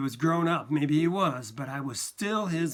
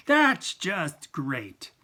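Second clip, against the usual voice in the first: very slow, louder, bitter repeat; bitter after slam; 5 dB louder, much slower than usual. A narrator's reading. Son repeating it after slamming his glass, seething slowly. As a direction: grind the words out after the slam.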